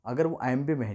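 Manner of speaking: ordinary delivery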